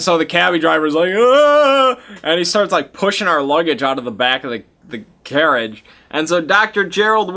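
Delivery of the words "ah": very high, loud startled shriek; cabbie's high, loud panicked scream; panicked yelp. Retold or imitated. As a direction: yelp in sudden fright.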